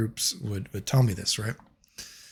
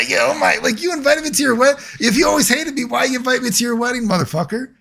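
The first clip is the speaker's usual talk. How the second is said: high, laughing incredulous retort; cracking up while throwing it back at him; laughing disbelief; 11 semitones higher than usual. Retold or imitated. To the friend who hated him, high laughing disbelief.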